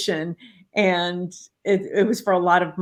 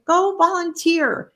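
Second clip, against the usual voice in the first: emphatic; 10 semitones higher, 5 dB louder, slower than usual. Retold or imitated. Coaching client emphatically.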